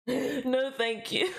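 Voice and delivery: embarrassed laugh through the words; laughing refusal. Real, not acted. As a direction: decline while giggling.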